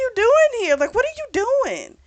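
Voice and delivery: high-pitched retold exasperation; exasperated questioning. Narrator voices a character demanding an explanation, exasperated. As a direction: act out an incredulous question.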